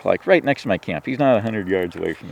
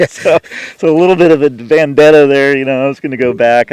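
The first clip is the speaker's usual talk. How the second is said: loud, high, still laughing through the words; 5 semitones higher, 11 dB louder than usual. Real, not acted. Telling a friend about his turkey vendetta, laughing.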